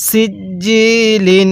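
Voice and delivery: সিজ্জিলিন with a thin s, firm doubled j and long i; thin sin, hard jim. Shown as a demonstration. Teacher models articulation for learners, slow সিজ্জিলিন with thin s and hard j. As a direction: hold the vowel after the hard j in সিজ্জিলিন. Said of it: "সিনটা চিকন করে জিমটা শক্ত করে".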